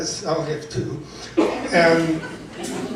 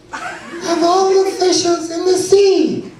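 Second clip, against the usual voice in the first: put-on inner child reciting; 10 semitones higher than usual. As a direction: recite a poem like a small child, pitched way up. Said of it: "my inner child wants to recite a poem".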